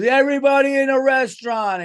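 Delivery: rally the whole restaurant in a hammed-up bellow; guy hollering. Party guy calling the whole restaurant to sing, mock holler. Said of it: "a guy goes".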